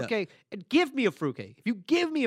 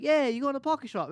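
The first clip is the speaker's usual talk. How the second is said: put-on British accent; 7 semitones higher than usual. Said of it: "he was like".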